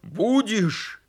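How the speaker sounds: high, shouted challenge; shouting. Acted. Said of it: "кричал он".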